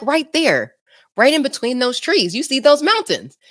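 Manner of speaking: high-pitched mock mom, insisting; imitating insistent mom. Mom voiced insisting in the story.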